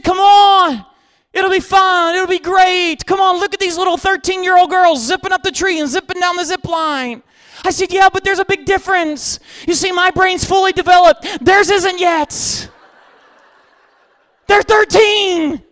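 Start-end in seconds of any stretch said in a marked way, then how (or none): 0.0-7.2 high, loud retold cajoling; pushy mob. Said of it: "ganged around me like an angry mob". 7.9-15.6 high, loud mock-outraged protest; exasperated retort. Retold shouted retort to the mob.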